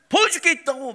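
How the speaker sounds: put-on bossy kid's shout, high and loud; demanding yell. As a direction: order it like an irate boy. Son insisting angrily.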